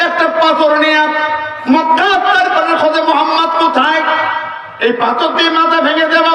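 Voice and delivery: high, heated threat; mock furious threat. Walid hunting the Prophet with a stone, raging threat. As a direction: hurl it like a raging rival.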